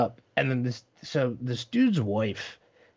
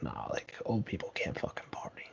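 put-on hushed, dismissive sneer of young kids; mock whisper; 7 semitones lower, 10 dB quieter than usual. Young kid scoffing at old folks, hushed and dismissive. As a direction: whisper it like a smug teen scoffing. Mocking young people's view.

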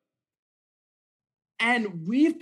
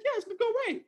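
much higher, light and dismissive reassurance; breezy doctor; much faster than usual. Retold or imitated. Sister doctor shrugging off his fear, breezy and much higher. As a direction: brush the worry off like a casual doctor. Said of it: "She's like".

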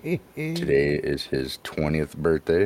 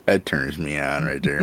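ordinary talk

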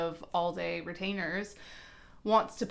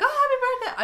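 mock chirpy greeting; 8 dB louder than usual. Imitating people seeing birthday posts.

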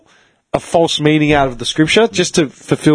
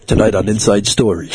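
low, mock-serious promo voice; TV announcer; 7 semitones lower than usual. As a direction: spoof current-affairs intro. Spoof TV exposé intro.

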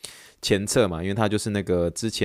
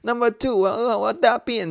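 put-on high, loud cracking kid voice; boy's desperate squeal, voice cracking; 14 semitones higher, 3 dB louder than usual. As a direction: squeal it so the voice breaks. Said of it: "他说".

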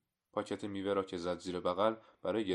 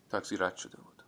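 usual speaking voice

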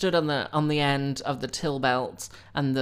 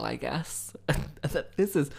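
usual speaking voice

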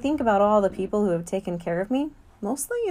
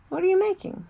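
curious man; 13 semitones higher, 4 dB louder than usual. Acted. Mac asks child about drawing.

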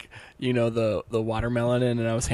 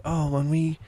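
high, swooning lofty-prose voice; hammed-up Victorian romantic, pitched high; mock Shakespearean lover; 5 semitones higher, 5 dB louder than usual. Host voicing prisoners' lofty letters, mock Shakespearean lover. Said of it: "lofty prose and stuff like".

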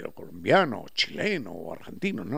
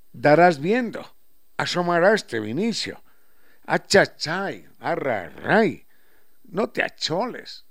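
slow, loud, drawn-out Sierra speech; Quichua-flavoured highland Spanish; 6 dB louder, slower than usual. Retold or imitated. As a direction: stretch "achachay" and "arrarray" slowly, the Andean way. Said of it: "Estamos hablando quichua".

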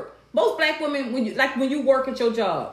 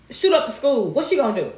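ordinary delivery

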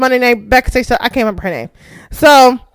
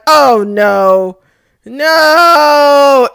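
long, drawn-out no of dismay; mock dread; 3 semitones higher, much slower than usual. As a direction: groan it out in exaggerated dread. Dreading the wrestler's entrance music.

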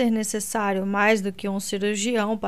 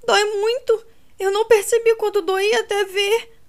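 high, louder anguished complaint; crying out in pain; 10 semitones higher, 7 dB louder than usual. A narrator's reading. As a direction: wail through the pain. Kate in great pain.

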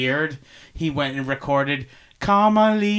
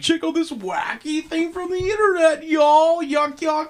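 much higher, goofy talk-show host voice; mock Jimmy Kimmel; 10 semitones higher than usual. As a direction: ham it up like a cheesy late-night host. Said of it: "kind of like Jimmy Kimmel".

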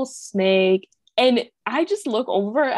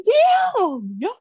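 exasperated damn; 8 semitones higher, slower than usual. Retold or imitated. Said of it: "I'm like".

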